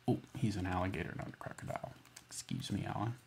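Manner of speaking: whispered correction; quiet aside to the book; hushed aside. Real, not acted. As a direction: mutter the apology under the breath.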